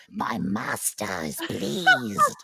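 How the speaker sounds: loud, slow creature voice; villain's crow minion. Shown as a demonstration. Giant crow serving the antagonist, creature minion. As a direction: announce it slowly like a monstrous crow henchman. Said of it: "swoop in and go".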